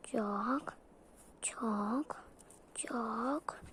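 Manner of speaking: hushed. Pretend. Sneaking, hushed.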